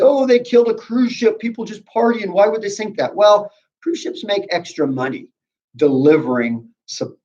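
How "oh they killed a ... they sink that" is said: higher, lightly voiced mock outrage; outraged public. Teacher voices angry Americans to students with mild mock outrage. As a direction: play the indignant public, half-hearted. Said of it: "They say".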